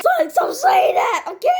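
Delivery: high-pitched pleading yell; exasperated shout. Acted. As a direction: cry out for them to stop. Fed up with repeated catchphrase.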